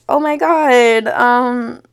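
slow, drawn-out mocking whine; a lightly voiced needy ex, slowed; mock guilty ex. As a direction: parody him pining, slowly. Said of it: "your guilty conscience was like".

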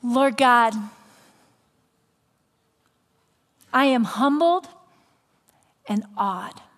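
reverent prayer with long pauses; humbled, awed opening prayer. Real, not acted. Pastor opens closing prayer.

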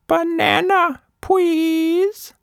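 put-on high, sweet little baby voice; tiny polite baby. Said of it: "innocent little baby Nina said".

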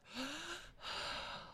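long, airy deep breath out; slow audible exhale. Pretend. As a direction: breathe out deeply as if for a doctor. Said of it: "nice deep breath".

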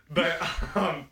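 normal talking voice

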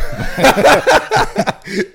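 high, bright laughing burst; a real laugh that jumps high; burst of laughter. Real, not acted. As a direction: laugh out, caught off guard. Laughs at being caught looking.

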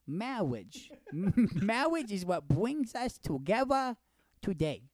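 high, drawn-out speech-impediment wedding voice; Princess Bride wedding officiant. Acted. Quoting the Princess Bride wedding to a cohost, high drawn-out officiant. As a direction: intone it grandly with mangled r's. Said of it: "The Princess Bride".

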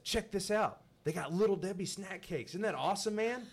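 high, loud gushing over snack cakes; thrilled shopper showing off ice cream; excited. Retold or imitated. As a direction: gush like a kid in a candy shop.

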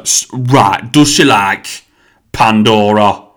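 loud, drawn-out Nottingham question; Nottingham hairdresser. Retold or imitated. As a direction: build up to the brand name, hammed-up Nottingham. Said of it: "She's like".

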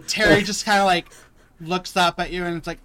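normal talking voice

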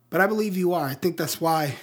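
ordinary talk, nothing marked

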